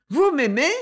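high, delighted question; joyful surprise, lifting upward; joyful exclamation. A narrator's reading. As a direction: cry it out in happy disbelief. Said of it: "un son de voix qui disait assez la joie".

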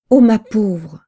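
mild narrated exclamation of dismay; crying out. A narrator's reading. Neighbour rushing in with bad news, crying out mildly. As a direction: cry out in pity, with some restraint. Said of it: "s'écria-t-elle".

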